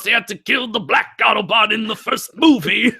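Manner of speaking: high, squealing comic complaint; mock outrage. Host jokes about the Transformers movie, mock-outraged. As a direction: squeal it in joking protest.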